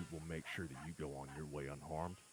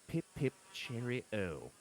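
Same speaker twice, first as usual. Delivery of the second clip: put-on slow, high posh English accent; posh British accent; 8 semitones higher, 8 dB louder, much slower than usual. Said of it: "Do it in your posh voice".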